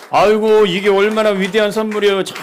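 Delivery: a loud, high cry of delight; delighted exclaim. Real, not acted. The pastor opens a gift from the mission team, crying out in loud, high delight.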